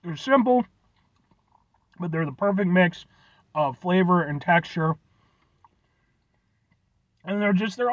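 eating between words; chewing while talking. Real, not acted. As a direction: mouth partly full. Seen 3: holds bitten empanada, lips close between phrases, chewing after earlier bite.

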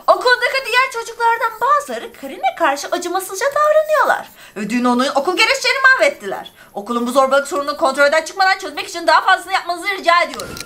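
high, put-on voice reading the school letter; letter read aloud. (mektubu yüksek, yapmacık bir sesle okuyor)

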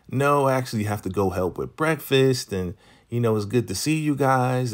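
high-pitched mock politeness; imitated polite brush-off. Joan excusing herself to the couple, high polite imitation.

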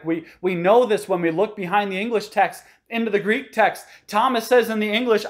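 ordinary talk, nothing marked

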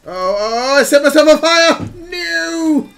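high, loud, fast cry of alarm; panicked yell. Real, not acted. Player on fire and panicking, panicked yell. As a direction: build into a high, frantic shout.

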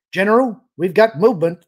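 loud, high call across the bridge. A narrator's reading. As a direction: sing out the alert to the general. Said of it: "the officer at the sensor station called".

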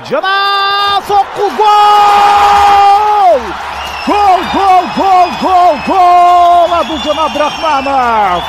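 high, loud stretched goal scream; long goal yell. Real, not acted. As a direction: hold the goal call as long as you can. Goal scored, commentator yells. (узак созулган гол кыйкырыгы)

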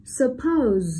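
suppose with POSE stressed and stretched; light su, then lean on POSE in suppose; careful model of suppose, weight on the end; stress on second syllable. Shown as a demonstration. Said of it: "trọng âm rơi vào âm tiết số hai".

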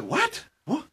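high, startled yelp of "What?"; retold disbelief. Teen brothers protesting mom's chore, startled high yelp. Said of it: "And we said".

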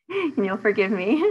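laughing while asking forgiveness; laughing apology. Real, not acted. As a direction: laugh through the words.

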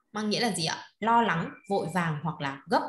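ordinary delivery

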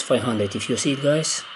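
ordinary talk, nothing marked

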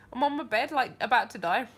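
normal talking voice

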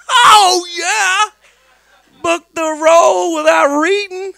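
high, loud, thrilled shout; excited shout. Retold or imitated. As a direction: shout it in pure glee. Ecstatic about booking role.

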